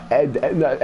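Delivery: usual speaking voice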